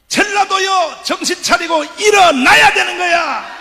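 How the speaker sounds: a preacher rousing a rally; fiery rally preaching. Acted. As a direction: preach it at the crowd full force. Rally sermon, full force.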